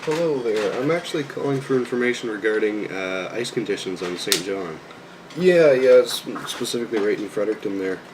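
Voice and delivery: polite customer service phone voice; friendly, formal voice for a call. Real, not acted. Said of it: "You guys got to hear my phone voice".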